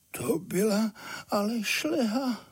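high-pitched, small devil's voice; sighing little devil. A narrator's reading. Little devil coming round after a blow, sighing. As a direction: sigh it out in a high, pained creature voice. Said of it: "a pak vzdychl".

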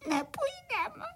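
put-on high, stammering childlike crying; tearful whimpering. Little crickets afraid of the cart, crying. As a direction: sob it out in a tiny high voice. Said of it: "Tu se však malí cvrčci rozplakali".

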